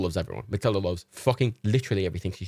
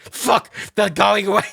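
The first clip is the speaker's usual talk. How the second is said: higher and louder, panicky; frantic dog voice; 9 semitones higher, 6 dB louder, slower than usual. Retold or imitated. Dog Matilda despairing at the window, higher and louder. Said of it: "my impressions of Reagan and Matilda".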